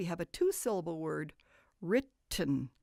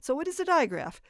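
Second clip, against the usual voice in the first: quizzing a stranger; 10 semitones higher, 7 dB louder than usual. Pretend. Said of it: "stop too many adults on the street and say".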